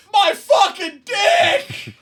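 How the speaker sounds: very high, drawn-out shriek of pain; hammed-up wail, stretching every word; mock agonized scream. Co-host imagining the bitten victim, in a high comic scream. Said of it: "the conversation was something like this".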